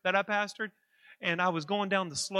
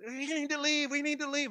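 mock screaming; 8 semitones higher than usual. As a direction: imitate a panicked crowd in high voice. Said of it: "everybody's screaming in the doctor's office".

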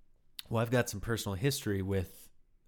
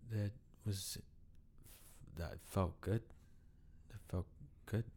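hushed; 10 dB quieter than usual. Retold or imitated. Dazed after crying, answering coach.

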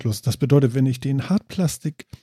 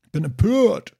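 mock outrage; 6 semitones higher, slower than usual. Mock outrage about drinking joke.

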